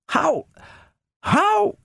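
very high, incredulous question; astonished. A narrator's reading. Donovan asks how the food appeared, astonished. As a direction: repeat it high, pausing in disbelief.

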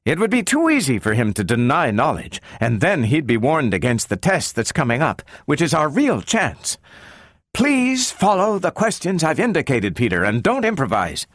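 high, urgent woman scientist; Doctor Calvin, animated. A narrator's reading. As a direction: instruct a colleague insistently, pitched high. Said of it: "Doctor Calvin's eyes sparkled to life".

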